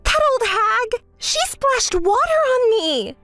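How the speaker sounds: high, drawn-out indignant wail; slow, high-pitched indignation; outraged complaint. Acted. Complains of being splashed.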